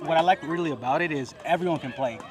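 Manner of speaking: ordinary talk, nothing marked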